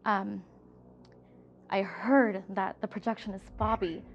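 usual speaking voice

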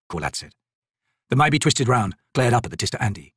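ordinary delivery, nothing marked

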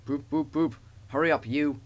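mildly cross big-engine hoot; hoot, then order with a touch of temper. A narrator's reading. Gordon waiting on late Thomas, mildly cross. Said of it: "said Gordon crossly".

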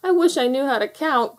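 put-on a touch of a child's wail; crying out. A narrator's reading. Rusty amid weeping foxes, unable to count, crying out. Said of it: "cried Rusty".

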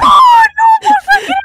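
dismayed yell. Real, not acted. Losing the game, cries out.

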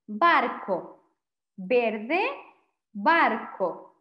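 "barco", "verde", "barco" con la misma b, baɾko y beɾðe, cada palabra separada y marcada. Shown as a demonstration. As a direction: comparar b y v sin diferencia. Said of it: "Presten atención". (para alumnos: "barco" y "verde", misma b)

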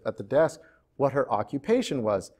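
usual speaking voice

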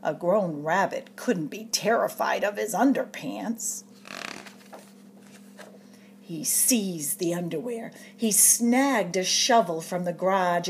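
usual speaking voice

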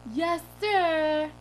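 high, loud, goofy obedience; silly dream-self voice. Retold or imitated. Driver obeying the officer, goofy compliant voice. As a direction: answer eagerly in a put-on high voice. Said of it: "I'm talking like this".

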